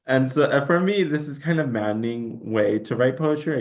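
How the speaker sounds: ordinary talk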